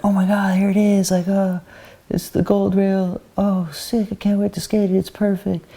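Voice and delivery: high, gushing stoked voice; thrilled skater seeing the gold rail; excited. Retold or imitated. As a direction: squeal like a kid at the spot. Arriving at the famous gold rail.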